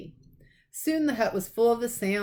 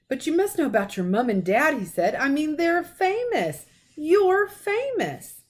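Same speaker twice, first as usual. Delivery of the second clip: much higher, very slow disbelief; astonished giant; 9 semitones higher, 4 dB louder than usual. A narrator's reading. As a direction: stretch it out in amazement.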